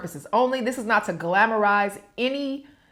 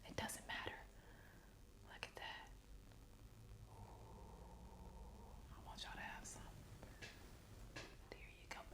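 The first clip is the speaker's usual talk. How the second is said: soft unvoiced whisper to the viewers; 30 dB quieter than usual. Real, not acted. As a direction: whisper it as if sharing a secret treat. Sharing food softly with viewers.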